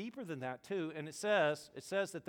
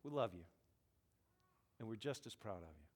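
tender parents; 6 semitones lower, 8 dB quieter than usual. Retold or imitated. Parents comforting their son after his loss, softly and tenderly. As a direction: gently tell him he's loved. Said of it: "they said".

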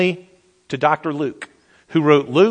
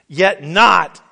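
scripture reading; 2 semitones higher, 4 dB louder, slower than usual. Acted.

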